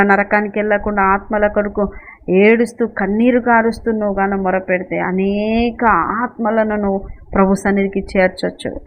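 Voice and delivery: usual speaking voice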